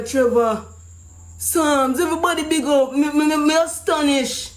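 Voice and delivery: ordinary delivery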